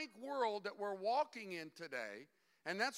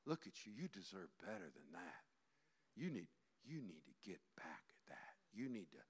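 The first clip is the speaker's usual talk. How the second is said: low, soft, sly devil whisper; the devil coaxing a self-pitying person, low and hushed; devil's coaxing voice; 11 dB quieter than usual. Acted. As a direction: hush it into their ear like a tempter. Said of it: "the devil puts his arms around you and goes".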